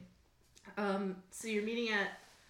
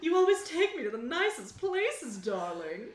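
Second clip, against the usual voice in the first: put-on rehacked young star voice; 4 dB louder than usual. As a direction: pitch it up, dropping on the last word. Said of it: "need to rehack my voice then".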